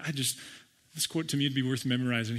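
normal talking voice

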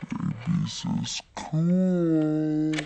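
Babies is cool dragged out slow and low; silly low drawn-out voice. Shown as a demonstration. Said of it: "repeats phrase in other voice".

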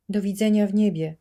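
called out high and loud after her; calling after her. A narrator's reading. Brother calls farewell after his sister being dragged away, loud. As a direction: shout a farewell down the hall. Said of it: "zawołał za nią brat".

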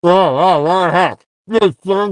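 chewing while talking; talking through a mouthful; mouth full. Real, not acted. Seen 0: man in cap chews with food visible in open mouth.

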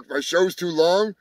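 ordinary talk, nothing marked

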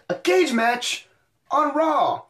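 excited. Real, not acted. Hyping the main event.

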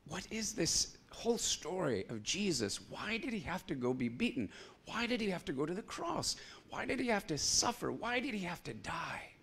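high, soft searching questions; pleading questions. Retold or imitated. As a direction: ask with a plaintive lift. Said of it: "He said".

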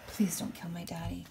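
desperate whispered plea; whispered prayer. Acted. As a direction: whisper it, barely voiced. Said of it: "I prayed to God".

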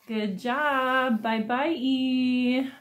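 ordinary talk, nothing marked

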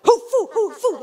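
high, forceful "hu, fu, hu, fu"; pronunciation drill. Shown as a demonstration. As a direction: hammer "hu" against "fu" in alternation. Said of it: "la única cosa que hace es te pone".

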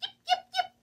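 very high, loud little fox yip. Acted. Little fox calls out to the party, high yipping. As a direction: yap like a tiny fox calling out. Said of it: "She perked up her ears and called".

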